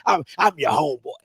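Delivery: low, dopey buddy voice; mock homeboy voice. Imitating the coach as a low, dopey buddy. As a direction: play the fake friend, low and dopey. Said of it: "repeats mocked quote".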